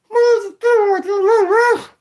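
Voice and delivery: very high, very slow dummy voice; squeaky drawn-out puppet voice; ventriloquist voice. Shown as a demonstration.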